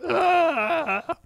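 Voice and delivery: put-on very high childish wail; mock crying scream. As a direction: shriek like a distraught kid. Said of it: "crying in front of their T Vs".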